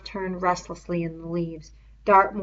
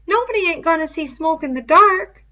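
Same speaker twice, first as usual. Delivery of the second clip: put-on higher, louder boy's voice; Piggy's voice; 10 semitones higher, 10 dB louder than usual. A narrator's reading. Piggy objecting to Ralph, higher boy voice.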